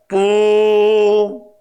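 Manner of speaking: long loud drawn-out horn sound; imitated trumpet blast, long and loud; trumpet blast imitation. Shown as a demonstration. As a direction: blow it like a ram's horn. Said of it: "양강나팔 소리가 울립니다".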